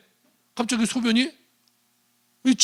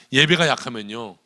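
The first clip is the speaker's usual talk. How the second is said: normal talking voice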